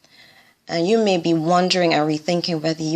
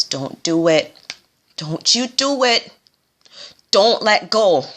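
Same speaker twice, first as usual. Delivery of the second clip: pleading Samwise; 3 semitones higher, slower than usual. Acted. Samwise begging Frodo at the fires, urgent plea. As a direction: beg him not to let go. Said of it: "this is what Samwise Gamgee said".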